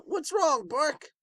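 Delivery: high-pitched, worried little voice; high character voice. Acted. A character checks on Hawk in a high voice. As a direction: ask what's wrong in a high voice.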